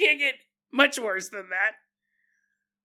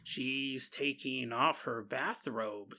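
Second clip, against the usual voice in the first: creepy demon voice; 7 semitones lower, 9 dB quieter, slower than usual. Pretend. Creepy demon narrating mom.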